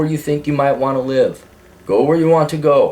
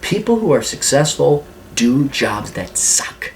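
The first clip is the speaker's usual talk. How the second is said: ordinary talk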